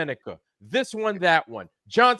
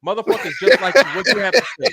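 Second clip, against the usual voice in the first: laughing; 5 dB louder, faster than usual. Real, not acted. Laughing while ranting.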